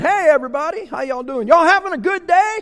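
high, loud, beaming hello to the whole restaurant; loud greeting. Retold or imitated. Greeting fast-food workers across the counter, loud and high. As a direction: call out cheerfully to everyone in the room. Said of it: "and I'm loud, okay?".

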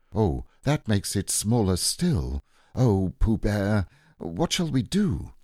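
slow, plaintive, rising lament; dismayed Christopher Robin. Acted. Boy despairing over the small boat, slow and plaintive. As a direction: lament helplessly, pitch climbing.

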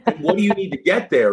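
high, lightly voiced lecturing mom; a hint of a tough-love mother laying out the rules; sounding like my mother. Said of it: "I sound like my mother".

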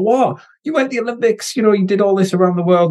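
high-pitched imitation of amazed people; mock disbelief. Swimmer voices people puzzled by his choice, high mock disbelief. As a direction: quote their astonishment, pitched right up.

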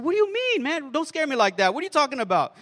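acted-out panic, pitch leaping high at the start; mock-rattled, high-pitched pushback; alarmed protest. Client protesting to his therapist's scary news, alarmed.

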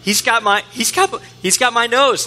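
put-on high-pitched panicked kid; mock terror. Imitating a kid who lost his nose, mock terror. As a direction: stammer it like a child who truly believes it. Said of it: "the look of sheer terror on your kid's face".

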